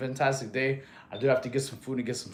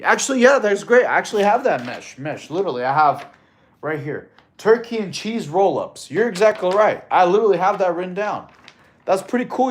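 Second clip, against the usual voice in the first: excited; 6 semitones higher, 8 dB louder than usual. Real, not acted. Excited about viewer's snack suggestion.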